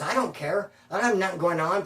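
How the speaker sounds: ordinary delivery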